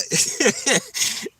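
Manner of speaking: high burst of laughter; real laughter. Real, not acted. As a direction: crack up at your own story. Laughing at own scream story.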